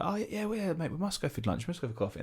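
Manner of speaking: high, light, eager agreement; softly gushing mock enthusiasm; fake-keen promise. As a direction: act out a mate making empty plans. Empty drunken promise acted out.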